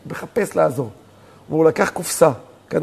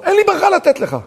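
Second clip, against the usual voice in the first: high-pitched, raised-voice refusal; emphatic refusal; 12 semitones higher, 4 dB louder than usual. Retold or imitated. Father refusing his son a blessing, emphatic raised voice.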